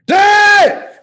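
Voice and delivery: put-on very high, loud kid yell; toddler's shout. Toddler demanding dad wake up, shouting. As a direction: blast it like an impatient child. Toddler escalates to yelling, acted.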